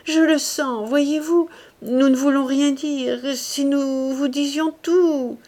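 an upset woman begging in a high, slow voice; pleading distress. A narrator's reading. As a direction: beg him, voice strained with grief. Distressed sister pleading with Rougon.

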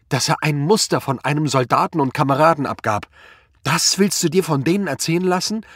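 high-pitched anguished tirade; screaming at him. A narrator's reading. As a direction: yell out your grief and outrage at him. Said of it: "schrie Anna ihn an". (ihn verzweifelt anschreiend)

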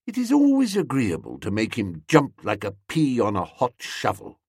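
ordinary delivery, nothing marked

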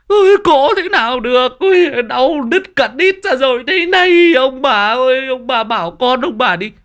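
very loud, high screeching wail; labour-pain wailing. Acted. Woman in labour snapping at in-laws, pained screech. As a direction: howl back in hammed-up agony.

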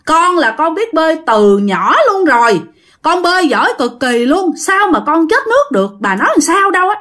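high-pitched, indignant boasting; loud protest. Acted. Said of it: "nó mới lớn tiếng nói". (lớn tiếng cãi lại, giọng cao)

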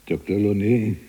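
first call to someone outside. Acted. Prisoner calls out for doctor.

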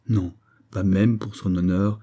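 ordinary delivery

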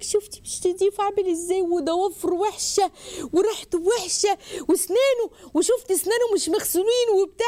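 high-pitched mock gossiping girl, put-on voice; disgusted girl. Girl telling her friend why the suitor is unclean, mock disgust. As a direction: imitate a fussy girl picking a suitor apart.

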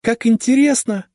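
put-on very high, thrilled girlish cry; excited exclamation. A narrator's reading. Sister eavesdropping at the door, delighted. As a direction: gasp with delight at the gossip. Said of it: "воскликнула Наталья".

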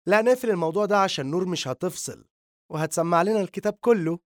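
put-on jealous girl cutting the pair off, loud and high; angry. A narrator's reading. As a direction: snap at them in jealous anger. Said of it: "غضبت هنا من طريقة كلامهما".

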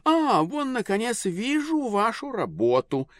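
high, slow, delighted lady; society lady voice. A narrator's reading. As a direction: gush over the paintings. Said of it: "сказала дама".

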